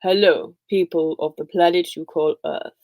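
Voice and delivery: a light Darth Vader baddie voice; lightly voiced sci-fi villain; Star Wars voice. Shown as a demonstration. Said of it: "in a more Star Warsy voice?".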